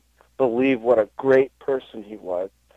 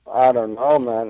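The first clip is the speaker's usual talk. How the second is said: normal talking voice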